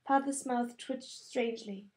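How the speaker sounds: plain reading voice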